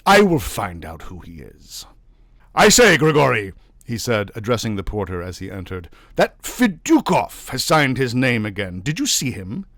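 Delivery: loud, high, exasperated official; official Navagin, irritated. A narrator's reading. Navagin questioning his porter, irritated. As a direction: press the porter for answers.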